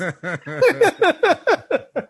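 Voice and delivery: a big, high burst of real laughter; loud high laugh. Real, not acted. Cracking up at his own Goodfellas bit, loud high laughter.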